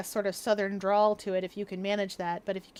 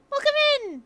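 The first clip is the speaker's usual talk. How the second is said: loud greeting; 7 dB louder, faster than usual. Real, not acted. Streamer interrupts the lesson to greet arrivals, loud high call-out. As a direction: call out a welcome at full voice.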